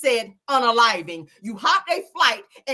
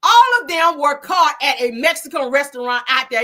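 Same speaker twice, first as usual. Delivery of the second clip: riled up; 4 semitones higher, 6 dB louder than usual. Real, not acted. Riled retelling of a confrontation.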